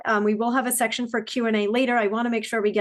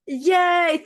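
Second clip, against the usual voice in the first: cheer; 8 semitones higher, 4 dB louder than usual. Real, not acted. Cheering her own intro.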